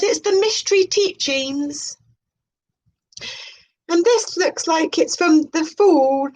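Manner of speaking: high, airy parody of a mystic presenter; mock spiritual host. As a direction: announce it like a dreamy new-age show host. Mock spiritual programme intro.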